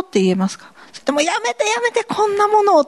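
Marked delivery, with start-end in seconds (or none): 1.2-2.8 much higher flustered protest; mock protest. A believer refusing to let others drink, high mock protest. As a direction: fluster and wave it away.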